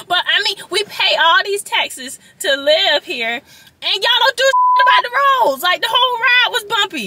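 high, loud, worked-up complaint; indignant rant. Real, not acted. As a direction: protest loudly at the top of your range. Ranting about taxes and bad roads.

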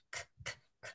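"k, k, k" as unvoiced breathy clicks, no voice; voiceless k sound. Shown as a demonstration. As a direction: tap out the bare k with no voice. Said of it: "termino con el sonido de k". ("k, k, k" sin voz, solo aire)

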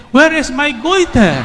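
high laughing exclamation; excited cry. Retold or imitated. Woman recalling her cure, excited and laughing. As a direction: cry it out with delighted surprise. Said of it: "I said".

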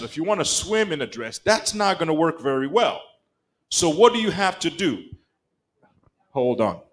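ordinary talk

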